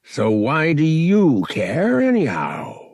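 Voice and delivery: slow, loud, suspicious demand. A narrator's reading. Drunk captain suspicious of the Wookiee, demanding. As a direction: draw it out, good humor gone. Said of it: "he demanded".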